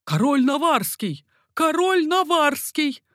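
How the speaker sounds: high, loud yell, stretched on the repeat. A narrator's reading. Sentries yell who is escaping, loud high shouting. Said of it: "орали часовые".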